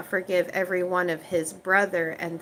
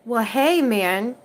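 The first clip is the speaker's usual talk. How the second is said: ordinary talk, nothing marked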